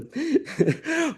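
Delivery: short high-pitched laugh; a brief laugh at your own joke. Real, not acted. Speaker jokes to mentors about grandma's ghost, short high laugh.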